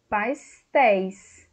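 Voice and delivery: pastéis said slowly and clearly; slow demo word. Shown as a demonstration. Teacher showing the plural of pastel, slow. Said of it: "plural de pastel".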